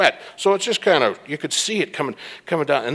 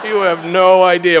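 laughing; 5 semitones higher than usual. Retold or imitated. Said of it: "And I started laughing".

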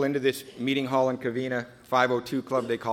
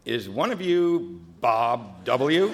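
slow, different voice of a fireman; tired fireman; much slower than usual. Retold or imitated. Fireman in charge asking for Bob at the bridge, slow and weary. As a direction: drag the question out like a weary fireman. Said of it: "fireman in charge looks over and goes".

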